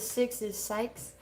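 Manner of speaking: "Sykes" with a long i in place of six; "Six" said plain, "Sykes" with the vowel of bikes. Shown as a demonstration. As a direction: read out "Six is Sykes" slowly. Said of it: "Onyx says".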